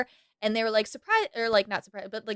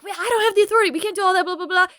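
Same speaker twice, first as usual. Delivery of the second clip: mock panic, pitched up; flustered cop protesting; panicky policeman; 8 semitones higher, much faster than usual. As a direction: policeman freaking out over terrorist demands. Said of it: "policeman's freaking out".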